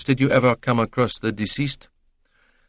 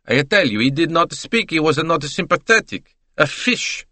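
chatty Italian witness. Acted. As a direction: play the talkative Italian salesman. Italian answers detective.

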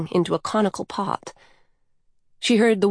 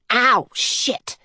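mild acted yelp of pain, pitched up; pained shout; 5 semitones higher than usual. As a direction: curse as if you just burned your fingers, not full volume. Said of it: "shaking it and shouting".

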